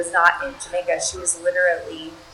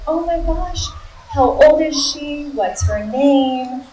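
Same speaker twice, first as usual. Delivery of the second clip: high, delighted motherly gushing; doting mama; 7 semitones higher, slower than usual. Retold or imitated. Helpline worker hearing of caller's daughter, doting mama. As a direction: imitate a mama cooing, pitched up and drawn out. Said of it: "into Jamaican mama".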